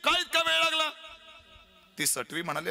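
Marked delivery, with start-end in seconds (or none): 0.0-0.9 enraged king scolding a late servant; Ravana shouting. Retold or imitated. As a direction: yell like a furious demon king. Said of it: "Shri Ravan तिला ओरडला".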